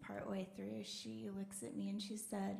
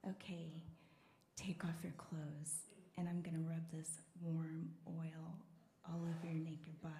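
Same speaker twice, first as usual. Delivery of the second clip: low breathy whisper, much lower and softer; seductive masseur; 6 semitones lower, 7 dB quieter than usual. Retold or imitated. Imitating the buff masseur inviting her in, a low seductive whisper. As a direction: purr it like a smooth masseur.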